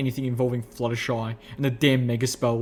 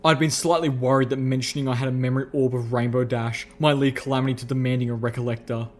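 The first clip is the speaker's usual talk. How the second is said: plain reading voice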